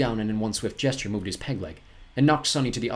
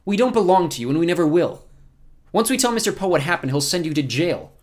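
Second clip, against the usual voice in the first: put-on higher, louder boy standing his ground; defiant boy; 8 semitones higher, 7 dB louder than usual. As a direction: stand up to the villain, high and firm.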